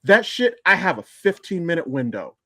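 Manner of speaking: normal talking voice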